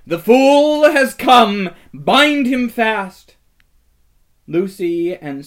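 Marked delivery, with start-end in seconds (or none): 0.1-3.4 high, loud and slow, gloating; wicked queen crowing her order; triumphant witch's cry. A narrator's reading. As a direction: savour the command like a victor. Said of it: "she cried".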